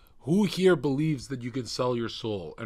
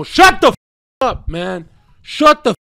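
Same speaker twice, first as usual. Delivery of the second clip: screaming, very high and loud; furious yell; 12 semitones higher, 19 dB louder than usual. Real, not acted. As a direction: scream it at the top of your lungs. Yells at interruption.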